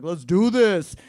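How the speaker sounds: loud, eager rallying cry; hyped-up retold cheer; gung-ho. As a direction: shout it like you're up for anything. Eager quote about getting drunk.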